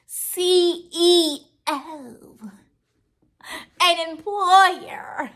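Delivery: fake-weep the words like a proud joke; mock crying.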